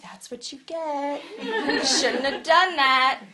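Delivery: drawn-out, laughing I-told-you-so; mock scolding. Pastor mimics readers judging the man, mock scolding. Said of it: "we would read that and be like".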